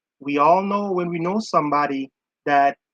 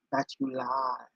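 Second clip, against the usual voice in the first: smooth and cool; 3 semitones lower, 9 dB quieter than usual. Retold or imitated. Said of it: "Johnny's like all smooth and cool".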